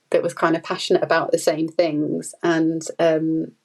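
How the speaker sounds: normal talking voice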